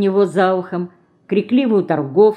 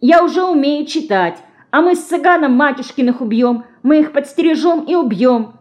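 fast; 8 semitones higher, 4 dB louder than usual. A narrator's reading. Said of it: "быстро перебил её".

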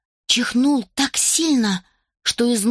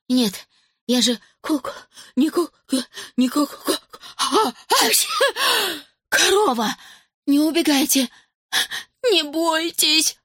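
high stammering plea; pitiful pleading; 7 semitones higher than usual. Acted. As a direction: beg them pitifully not to run. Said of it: "жалостно просил Зак".

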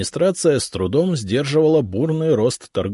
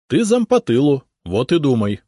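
curt; 5 semitones higher, 5 dB louder than usual. A narrator's reading. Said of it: "отрезал я".

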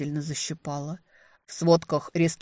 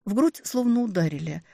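ordinary delivery

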